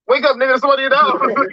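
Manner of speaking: high, loud urgent call to wake him. Pretend. Husband rousing his wife, urgent and loud. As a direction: shake him awake in a panic.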